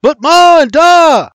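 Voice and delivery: high, loud objecting yell; full-voice desperate protest; shouted protest. Acted. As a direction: shout back for those left behind. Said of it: "his brother shouts".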